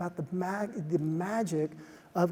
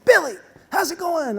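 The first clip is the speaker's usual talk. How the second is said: high, loud bright hello to a coworker; cheery greeting; 11 semitones higher, 10 dB louder than usual. Retold or imitated. Speaker greeting the gym repairman, eager and loud. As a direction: call out his name with big enthusiasm.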